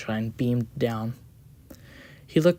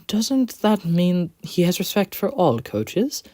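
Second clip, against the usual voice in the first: put-on light, higher young engine voice; a cheerful little engine; Quincy voice; 7 semitones higher, 3 dB louder than usual. A narrator's reading.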